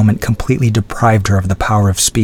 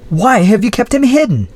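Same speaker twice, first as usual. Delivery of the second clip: much higher, shocked demand; upset cry; 13 semitones higher than usual. Acted. Adrienne demanding of the Baron, shocked and high. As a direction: cry it out in disbelief. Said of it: "cried Adrienne".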